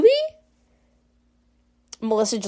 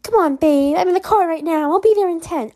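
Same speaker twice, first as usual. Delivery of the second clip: pushy, higher-pitched coaxing; [name]; 6 semitones higher, 4 dB louder than usual. Acted. Caller insisting on coming over in ten, higher-pitched and pushy. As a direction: wheedle insistently with pitch raised. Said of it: "I love [name]'s voice here".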